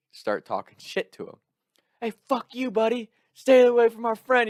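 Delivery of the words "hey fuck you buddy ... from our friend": high-pitched retold yell, building louder; mock screaming. Friends talking shit to a guy being thrown out, mock screaming. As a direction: imitate yelling threats across a bar. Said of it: "we're screaming back and forth".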